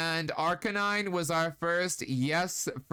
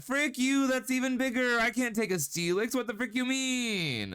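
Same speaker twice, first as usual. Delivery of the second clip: mock outrage; 7 semitones higher than usual. Real, not acted. Shocked by bigger Pokemon.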